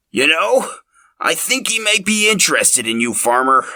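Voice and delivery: put-on a sly old man leaning in with gossip; old man Linus.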